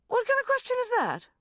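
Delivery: loud, high challenge; indignant growl. A narrator's reading. Sharon confronting the banker about his question, indignant growl. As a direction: round on him fiercely. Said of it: "she growled".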